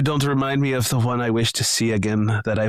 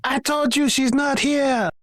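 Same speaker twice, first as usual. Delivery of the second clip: exasperated; 13 semitones higher than usual. Acted. Frustrated high retort.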